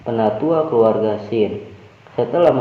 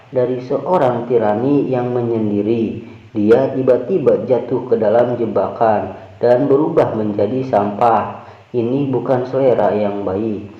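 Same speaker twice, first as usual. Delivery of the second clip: ordinary delivery, nothing marked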